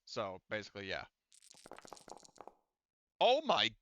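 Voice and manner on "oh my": high, loud, drawn-out exclamation with a laugh; astonished outburst. Real, not acted. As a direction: cry out in real amazement at the roll. GM stunned by a huge roll.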